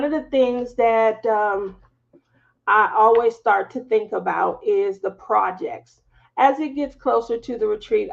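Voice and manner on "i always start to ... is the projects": ordinary talk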